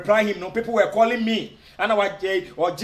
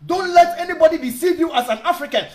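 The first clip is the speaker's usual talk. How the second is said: emphatic warning; 7 semitones higher than usual. Shown as a demonstration. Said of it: "repeated, building to emphatic warning".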